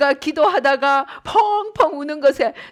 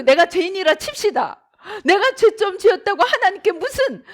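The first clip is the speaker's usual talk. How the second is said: Job's crying complaint, higher and louder; tearful protest; 3 semitones higher, 3 dB louder than usual. Retold or imitated. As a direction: sob out the protest to God. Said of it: "하고 항의를 합니다". (울먹이며 하나님께 항의하는 욥)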